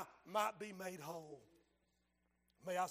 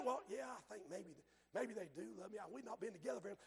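unsure mumble; 11 dB quieter, much faster than usual. Retold or imitated. Voicing unsure listener.

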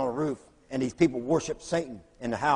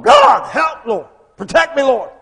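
frantic prayer; 10 semitones higher, 10 dB louder, slower than usual. Retold or imitated. Crying out for help on the roof.

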